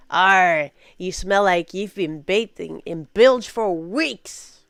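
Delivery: loud, slow pirate snarl; slow, loud pirate insult about bilge. Shown as a demonstration. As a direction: insult them like a gruff pirate. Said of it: "you could say instead".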